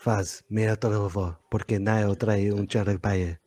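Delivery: low, slow dubbed voice; mock Spanish dub. Dubbing the clip in Spanish.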